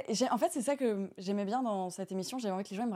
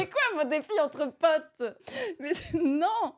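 laughing outrage; 7 semitones higher, 3 dB louder, much slower than usual. Real, not acted. Outraged at silly dare, laughing.

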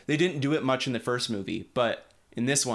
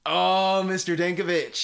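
surprised; 7 semitones higher, 4 dB louder than usual. Retold or imitated. Surprised greeting of landlord.

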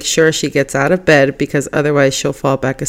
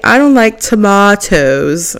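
imitating a child's British accent, drawn out; slow, lightly mimicked British-accented kid; 3 semitones higher, slower than usual. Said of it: "saying some words with British accents".

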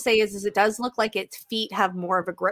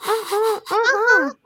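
high teasing uh-huh; 13 semitones higher, slower than usual. Pretend. Teasing playful response.

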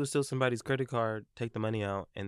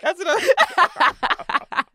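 laughing; 13 semitones higher, 9 dB louder, much slower than usual. Real, not acted. Both laughing.

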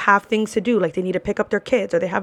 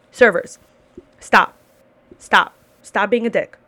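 louder, sharp repeated stop; loud command; 4 dB louder than usual. Real, not acted. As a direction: bark stop at them. Scolding servers in her rant.

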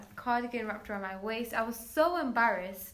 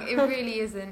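ordinary talk, nothing marked

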